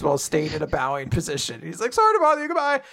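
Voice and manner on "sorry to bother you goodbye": much higher, panicky little give-up; a coward blurting his surrender; mock terrified squeak, pitched way up; squeaky cowardly surrender. Finn backs away bowing.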